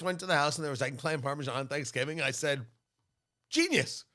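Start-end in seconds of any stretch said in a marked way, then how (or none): 3.5-4.0 high-pitched, loud burst of delighted revelation; excited mock exclamation. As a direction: cry it out, stretching the question. Acts out first Thanksgiving delight.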